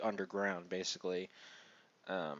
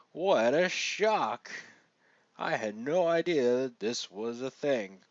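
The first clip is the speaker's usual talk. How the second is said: mock-shocked, louder and higher, drawn out; sarcastic; 4 semitones higher, 5 dB louder, slower than usual. Reviewer mocking a predictable plot reveal to viewers, heavily sarcastic. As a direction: feign huge surprise with exaggerated, swooping emphasis. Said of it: "detect my sarcasm there".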